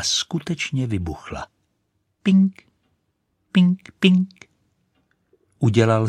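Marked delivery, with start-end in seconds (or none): 2.2-4.3 popping bang. Acted. Firework popping.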